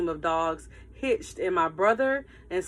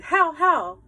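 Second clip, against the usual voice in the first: high, loud panicked command; 8 semitones higher, 7 dB louder than usual. A narrator's reading. Girl nearly thrown off the sled, panicked shout.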